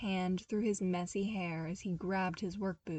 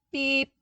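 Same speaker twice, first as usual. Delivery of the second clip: sharp high electronic beep; insistent datapad beep; imitated beep; 6 semitones higher, 8 dB louder than usual. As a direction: chirp it like a datapad alarm. Datapad alarm going off.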